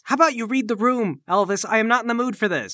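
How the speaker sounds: high, irritated snapping at a cellmate; exasperated. Acted. Acted quarrel between cellmates.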